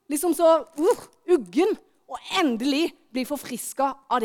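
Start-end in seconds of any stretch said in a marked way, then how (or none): none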